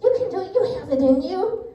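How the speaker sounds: high, eager cheer of encouragement; root for them like a coach from the sidelines. Retold or imitated. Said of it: "cheering you on".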